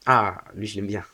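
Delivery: ordinary talk, nothing marked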